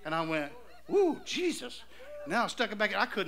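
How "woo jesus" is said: high-pitched "woo" of amazement; stunned exclamation. Retold or imitated. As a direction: re-enact a jolt of astonishment, pitch leaping up. Shock at one million dollars.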